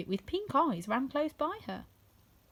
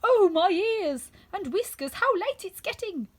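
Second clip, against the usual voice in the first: much higher, loud and slow; 9 semitones higher, 8 dB louder, slower than usual. A narrator's reading. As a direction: wail it like a rabbit out of time. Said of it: "hear it say".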